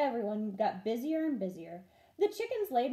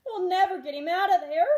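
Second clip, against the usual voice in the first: a higher, louder moan, lightly played; 8 semitones higher, 8 dB louder than usual. Mother moans seeing Ralph locked in the cage. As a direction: groan out the hopelessness. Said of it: "moaned their mother".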